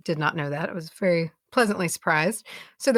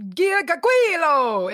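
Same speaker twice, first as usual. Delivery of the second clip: Giacaquilo said with a hammed-up Italian accent, pitch up; mock Italian accent; 9 semitones higher, 3 dB louder, slower than usual. Guest reading the plant name on request, mock Italian accent. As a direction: roll Giacaquilo out in a stage-kid Italian accent. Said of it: "Do it in your Italian accent".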